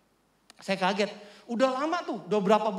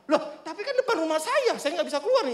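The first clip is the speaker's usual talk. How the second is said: high-pitched exasperated objection; retold protest; 7 semitones higher than usual. Homeowner protesting his blocked gate, exasperated. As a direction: act out pleading indignantly with a neighbour.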